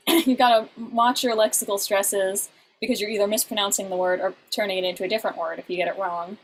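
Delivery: usual speaking voice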